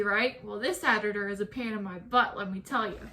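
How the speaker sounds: ordinary talk, nothing marked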